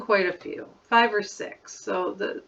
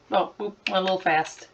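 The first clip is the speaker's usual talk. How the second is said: ordinary talk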